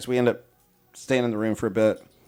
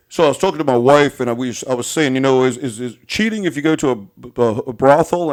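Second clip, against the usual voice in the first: louder, dopey mock driver; mocking driver's question; 6 dB louder than usual. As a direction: ask it like a clueless oaf. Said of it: "oh, he says".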